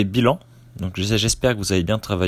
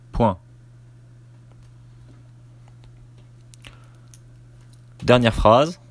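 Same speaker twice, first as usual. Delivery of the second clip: dictating. Shown as a demonstration. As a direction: dictate it chunk by chunk, naming the punctuation. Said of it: "Je commence la dictée".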